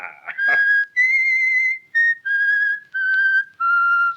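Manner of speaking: a high whistled tune; whistling. Acted. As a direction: whistle a jaunty tune while dressing. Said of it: "I can't stand that whistling".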